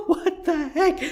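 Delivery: high, laughing disbelief; incredulous laughing. Real, not acted. YouTuber reacting to union threats in Sweden, laughing incredulously. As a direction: laugh helplessly, pitch shooting up.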